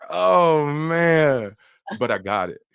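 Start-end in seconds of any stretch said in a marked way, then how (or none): 0.1-1.5 mock dismay. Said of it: "I was like".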